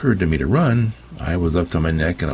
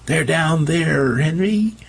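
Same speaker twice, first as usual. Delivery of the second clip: much higher, slow panicked shriek; 12 semitones higher, slower than usual. Acted. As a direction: scream in fright. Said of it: "Gwen's shriek came from upstairs".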